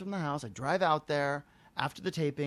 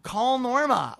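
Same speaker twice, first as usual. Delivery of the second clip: raised, exasperated demand; pissed off; 7 semitones higher, 6 dB louder, much slower than usual. Retold or imitated. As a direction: snap it higher and louder, retelling your anger. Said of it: "now I'm really getting pissed".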